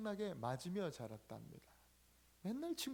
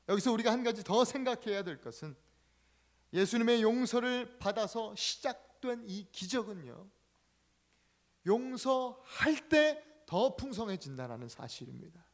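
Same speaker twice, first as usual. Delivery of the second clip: louder, higher sermon drive; full-force preaching; 6 semitones higher, 7 dB louder than usual. Acted. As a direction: preach it out to the congregation.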